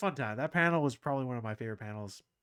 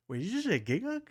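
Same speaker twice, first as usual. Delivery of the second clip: surprised; 10 semitones higher, 7 dB louder, faster than usual. Retold or imitated. Reacting to hearing Giga's name.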